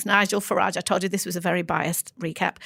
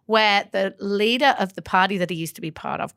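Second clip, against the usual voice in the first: ordinary talk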